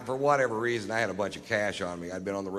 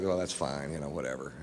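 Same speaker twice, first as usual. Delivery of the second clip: faked nonchalance; 8 semitones lower, 6 dB quieter than usual. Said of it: "And I was like".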